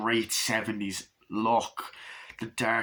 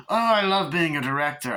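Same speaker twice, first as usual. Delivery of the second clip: higher, louder, gushing director voice; theatrical luvvie director, pitched up and louder; Eddie Izzard impression; 6 semitones higher, 4 dB louder than usual. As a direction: ham up the delighted director. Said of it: "that sounds more like ... Eddie Izzard".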